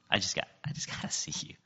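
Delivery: breathy hushed whisper; soft intimate whisper to God; whispered plea. Retold or imitated. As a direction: whisper it like a secret longing. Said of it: "he probably whispered it".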